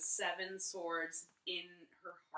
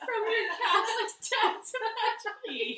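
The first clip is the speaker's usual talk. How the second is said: high, loud laughing wheeze of words; barely getting the phrase out; cracking up; 13 semitones higher, 12 dB louder than usual. Real, not acted. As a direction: fight through real laughter to finish. Cracking up over garbled title.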